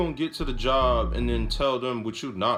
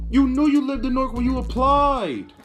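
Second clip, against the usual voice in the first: louder, higher, fed-up exclamation; exasperated rant; 10 semitones higher, 6 dB louder than usual. Real, not acted. Ranting to listeners about a lazy coworker, loud and fed up. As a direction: hammer home the blame, voice up high.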